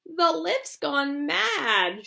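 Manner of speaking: put-on a frightened old man protesting; high slow old grandpa, worried; alarmed Grandpa Joe. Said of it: "shouted Grandpa Joe".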